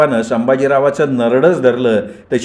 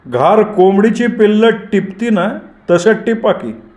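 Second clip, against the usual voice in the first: higher, slow, taunting gangster voice; sinister gang boss; 8 semitones higher than usual. Acted. Gani telling Sambhajirao to snatch boys like a kite, slow and sinister. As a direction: savour the cruel image slowly.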